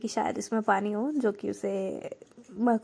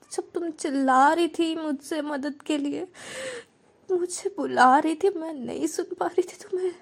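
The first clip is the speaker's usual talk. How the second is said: higher, voice breaking into tears; crying confession; 6 semitones higher than usual. Acted. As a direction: sob it out, guilty and overwhelmed. Girl breaks down confessing guilt. (रोते हुए टूटकर बोलना)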